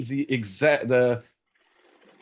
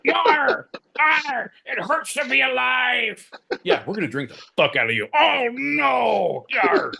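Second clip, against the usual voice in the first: high, strained pirate cries at full shout; pirate bottle voice; 14 semitones higher, slower than usual. Pretend. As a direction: shriek like a tortured rum bottle pirate. Sentient rum bottle pirate voice.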